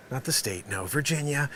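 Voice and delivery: ordinary talk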